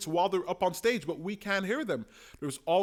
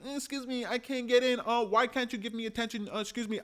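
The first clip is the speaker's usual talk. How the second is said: higher, whiny imitation of a loser begging to be heard; mock needy interrupter, a notch higher; pathetic loser whine; 7 semitones higher than usual. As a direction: play a pitiful nobody pleading for attention. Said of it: "some pathetic loser who's like".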